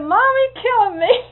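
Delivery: put-on high, loud wail of a hurt kid; child's scream. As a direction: scream it like a little boy in pain. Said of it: "I screamed and screamed".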